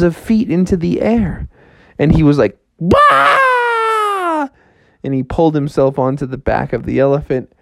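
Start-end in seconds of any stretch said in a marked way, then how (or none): 2.8-4.5 sudden high yelp of fright; startled cry. Acted. Frog realising he is high up, startled yelp. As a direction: yelp in shock.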